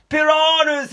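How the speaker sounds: high, loud panicked yell; yelled warning. Acted. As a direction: scream the warning. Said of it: "he yelled".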